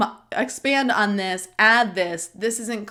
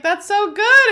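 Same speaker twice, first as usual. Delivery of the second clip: high, loud delighted exclamation; a husband cheering her news; voicing Michael; 12 semitones higher, 9 dB louder than usual. Michael's reaction voiced.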